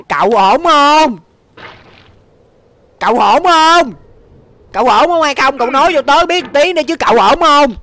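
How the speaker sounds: high-pitched and loud, anxiously asking if they're okay; shrill cartoon worrier pleading; squeaky worried voice. Holds a squeaky worried character voice.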